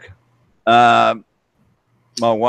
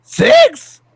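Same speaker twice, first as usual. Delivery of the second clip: surprised; 5 dB louder, much slower than usual. Real, not acted. Astonished at six kids.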